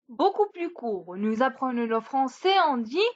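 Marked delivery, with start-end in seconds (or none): none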